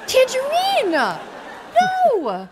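high, drawn-out scandalised cry; outraged refusal. Acted. Princess refusing Tangerine as wedding officiant, outraged. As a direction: wail the refusal in mock outrage.

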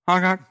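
honk imitation. Imitating apology car honk.

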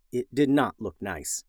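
plain reading voice

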